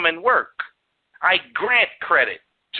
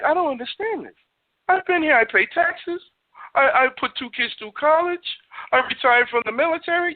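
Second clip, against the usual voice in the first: imitated crying man; 6 semitones higher than usual. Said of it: "he crying".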